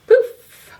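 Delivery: high, loud poof; magic poof. Acted. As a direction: burst it out like a spell. Magic spell sound effect.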